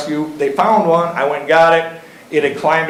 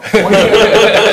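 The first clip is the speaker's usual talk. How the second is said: a hearty real laugh; loud laugh; 5 semitones higher, 6 dB louder than usual. Real, not acted. Laughs at his own retort.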